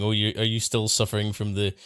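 ordinary talk, nothing marked

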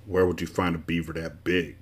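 low, soft grumble; grumbling. A narrator's reading. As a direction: grouse under your breath. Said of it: "grumbled the humbug".

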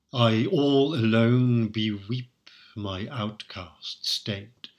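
slow, measured verse reading; poem recital. Shown as a demonstration. Lecturer quoting the sonnet aloud, slow recital. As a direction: savour each word of the verse.

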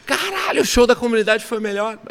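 retold gushing exclamation, pitched well up; imitated amazed crowd. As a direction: mimic an impressed fan, voice jumping up high. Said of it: "A galera".

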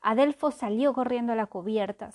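usual speaking voice

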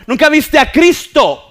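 loud, high, driving sermon delivery; impassioned preaching. Real, not acted. Preacher challenging congregation about blindness from birth, impassioned preaching. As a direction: press the challenge home at full force.

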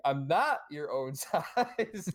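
very slow, each word stressed; slow word-by-word emphasis. Shown as a demonstration. Imagined recording session, slow stressed words. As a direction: plod through each word. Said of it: "emphasize every different word".

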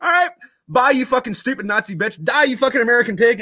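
very high, cheery parting abuse; mock insults. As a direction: act out gleeful farewell insults. Soldiers trade parting insults.

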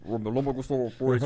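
distorted anonymous voice; mock distorted prank-audio voice; voice changer. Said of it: "a voz distorcida".

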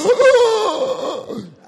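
huge high sobbing gasp; exaggerated loud wail of breath; hammed-up uncontrollable crying noise; mock crying gasp. Shown as a demonstration. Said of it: "you know that crying, when you're like".

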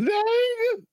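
very high zing. Pretend. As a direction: shriek it up high. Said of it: "zing voice".

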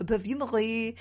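"perfumery" in a playful attempt at French; hesitant mock-French "perfumery"; French-style pronunciation. Shown as a demonstration. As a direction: put a French lilt on "perfumery". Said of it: "you say in French".

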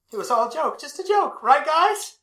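kneeling Duke pleads it was a joke; pleading nervous laugh-off. Acted. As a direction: laugh it off in a desperate squeak. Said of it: "he kneels at the Duke's feet".

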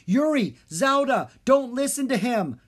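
high, loud pleading shout; loud desperate yell. Acted. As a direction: cry out to the twins to break the spell. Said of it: "Drake yelled".

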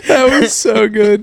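high, slow, laughing delight; cracking up, pitch shooting way up; laughing hard. Real, not acted. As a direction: say it through real laughter. Cracking up at the accent slip.

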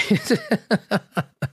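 laughing. Real, not acted. Laughs at own story.

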